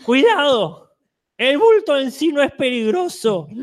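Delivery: high, fading voice from beyond; a grandmother spirit slipping away; ghostly old woman. Acted. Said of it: "Una voz de ultratumba de una anciana".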